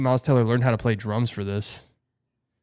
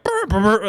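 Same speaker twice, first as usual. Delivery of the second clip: high, loud sputtering lip buzz; horrible trumpet buzz; 8 semitones higher, 5 dB louder than usual. Retold or imitated. A kid plays dreadfully at his chair test, loud buzz. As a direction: sputter like a badly played trumpet. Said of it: "it sounded horrible".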